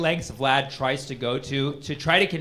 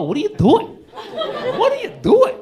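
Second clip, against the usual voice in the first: squeaky high mock outrage, much higher; mock exasperation; 6 dB louder than usual. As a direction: throw it up high in disbelief.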